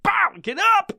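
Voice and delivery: high, punchy command; voice of his heart. Retold or imitated. Heart rousing man trying to sleep, commanding. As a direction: jolt him awake with a high cry. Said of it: "my heart's going".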